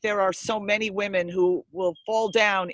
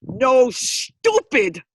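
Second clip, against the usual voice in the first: very slow, louder mock retort; blunt teen retort; 4 semitones higher, 6 dB louder, much slower than usual. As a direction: drag it out bluntly, mocking. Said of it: "what Hannah said to you is".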